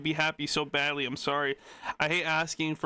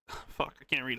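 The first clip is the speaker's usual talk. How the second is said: ordinary delivery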